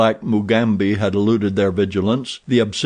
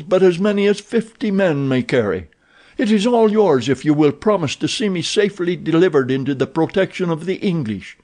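higher, earnest vow; earnest prisoner; 6 semitones higher than usual. Acted. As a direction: stake your life on it. Werper swears to the gold.

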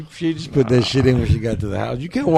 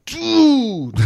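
long, high-pitched dude; drawn-out high protest, played for laughs; mock dismayed whine; 12 semitones higher than usual. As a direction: wail it high, imitating your younger heartbroken self. Acts his old dismayed reaction.

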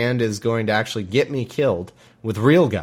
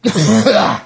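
strained cough; 5 semitones higher, 8 dB louder than usual. Retold or imitated. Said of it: "he must cough a lot trying to talk like that".

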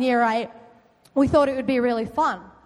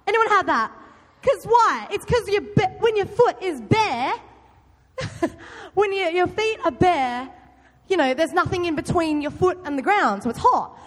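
normal talking voice

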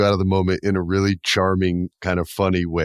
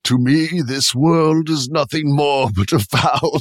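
slow, raised theatrical recital; Hamlet; 5 semitones higher than usual. Retold or imitated. Corpse doing Hamlet mid-scene, slow and theatrical. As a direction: declaim like Hamlet on stage. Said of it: "he's just doing Hamlet".

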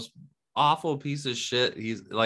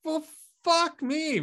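mock exasperation; 14 semitones higher, slower than usual. Retold exasperation.

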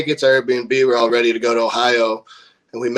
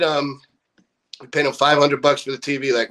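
ordinary delivery

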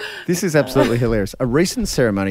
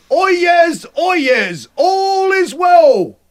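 higher, louder crier's call, stretched slowly; town crier; 5 dB louder, much slower than usual. Retold or imitated. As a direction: bellow it like a town crier at a ceremony. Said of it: "this is his exact quote".

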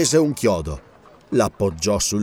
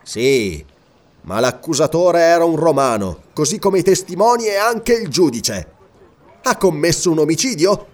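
higher-pitched, indignant protest with contained menace; repressed anger; 7 semitones higher, 3 dB louder than usual. A narrator's reading. King challenges a Roman verdict, repressed anger. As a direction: argue hotly, holding back the rage. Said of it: "l'ira repressa non riusciva a nascondere la minaccia".

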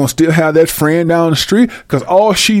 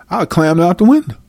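ordinary talk

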